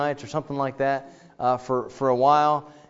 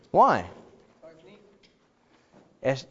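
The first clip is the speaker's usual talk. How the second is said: ordinary talk, nothing marked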